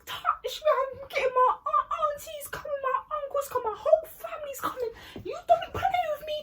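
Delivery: high-pitched, hammed-up shrieking threat; mock screamed warning in a put-on voice; Kelly shouting. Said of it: "she was shouting".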